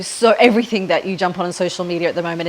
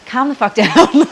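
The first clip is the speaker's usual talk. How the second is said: retelling husband's words through a laugh, a bit louder; laughing quote; 4 semitones higher, 5 dB louder than usual. Retold or imitated. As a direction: laugh while quoting him. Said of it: "And he's like".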